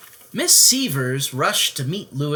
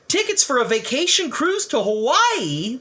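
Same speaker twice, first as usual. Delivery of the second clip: gasping; 12 semitones higher, 4 dB louder than usual. Acted. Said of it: "they gasped".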